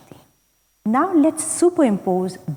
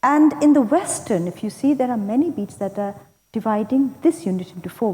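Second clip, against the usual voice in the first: ordinary talk, nothing marked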